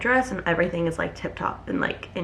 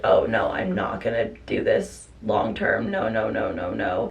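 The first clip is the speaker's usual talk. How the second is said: inner voice; 10 semitones lower, 3 dB quieter, slower than usual. Retold or imitated. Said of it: "I was like".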